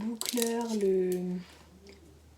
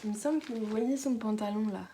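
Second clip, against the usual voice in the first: normal talking voice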